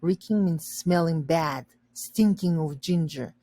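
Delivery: usual speaking voice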